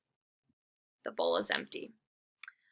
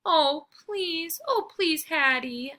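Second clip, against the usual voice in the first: moaning plea; 8 semitones higher, 3 dB louder, slower than usual. Acted. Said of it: "I moan".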